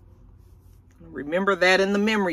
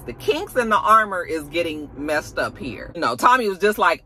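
ordinary talk

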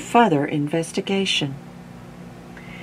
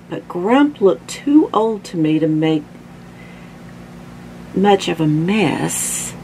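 usual speaking voice

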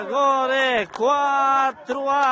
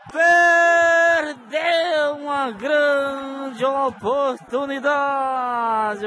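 drawn-out shout; 3 semitones higher, much slower than usual. Real, not acted. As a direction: wail it out over the miss. Near miss, drawn-out cry.

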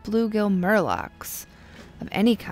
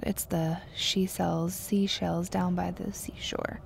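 whisper; 7 dB quieter than usual. Real, not acted. Muttering the twister to self.

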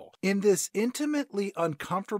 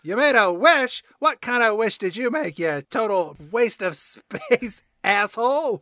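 hammed-up contempt; scornful cat; 3 semitones higher, 3 dB louder than usual. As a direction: jeer it, slowing on the insult. Acts out Grumpy Cat's scornful reply.

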